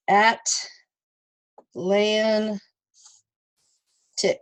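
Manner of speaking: "at", "lan", "tick" with long gaps; slow teacherly breakdown "at, lan, tick"; separated syllables. Shown as a demonstration. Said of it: "you separate it at the".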